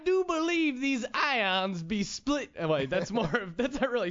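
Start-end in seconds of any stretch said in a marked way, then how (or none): 0.0-2.5 high, slow, hammed-up Southern drawl; mock Southern drawl. To Logan, joking about Southern scientists, in a hammed-up Southern drawl. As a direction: play a Southern genius splitting ions. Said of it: "you don't picture Albert Einstein with a Southern drawl".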